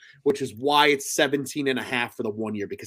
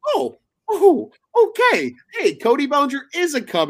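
excited; 13 semitones higher, 6 dB louder than usual. Retold or imitated. Retells his reaction to breaking news.

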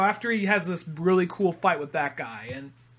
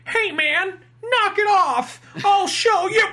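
very high, loud mock challenge; squeaky heroic yell; 13 semitones higher, 5 dB louder, slower than usual. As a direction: yell like a puny would-be hero. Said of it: "the one guy who's like".

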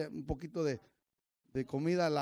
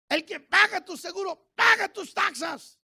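high, loud emphatic listing; preacher's shout; 12 semitones higher, 9 dB louder than usual. Real, not acted. Preacher listing what God pays for, shouted. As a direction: hammer home each item loudly.

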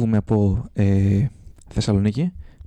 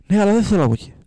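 higher, louder whiny protest; stubborn complaint; 9 semitones higher, 3 dB louder, faster than usual. Retold or imitated. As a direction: dig in like a stubborn traveller. Stubborn George argues with guard.

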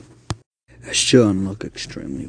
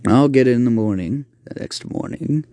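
ordinary delivery

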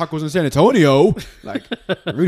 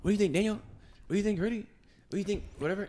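higher, softer, teasing LeBron on a live stream; LeBron asking around; 7 semitones higher, 12 dB quieter than usual. Pretend. LeBron asks followers on Instagram Live, pitched up and soft. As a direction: play LeBron quizzing his followers, pitched up. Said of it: "he's going to everybody saying".

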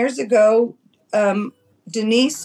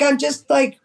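normal talking voice

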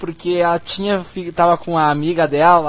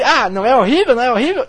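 incredulous Chandler; 4 semitones higher, 6 dB louder, faster than usual. Retold or imitated. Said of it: "Aí ele".

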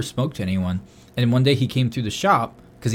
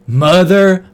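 stunned curse; 8 semitones higher, 11 dB louder than usual. Retold or imitated. Realizing the silent coworker speaks English, loud stunned curse.

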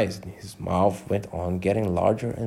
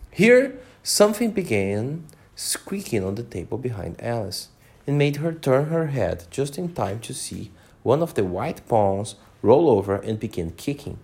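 ordinary delivery, nothing marked

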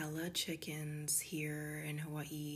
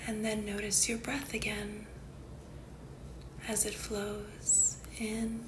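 guided meditation; 3 semitones higher, 3 dB louder than usual. Acted. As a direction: guide the listener unhurriedly into calm, phrase by phrase.

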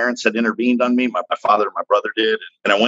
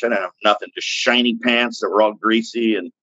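ordinary delivery

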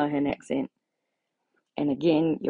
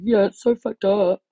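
louder, higher sneering mock-sympathy; 5 semitones higher, 5 dB louder than usual. Mocking [name]'s late outrage, louder sneering parody. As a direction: sneer out her belated outrage. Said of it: "only now you're like".

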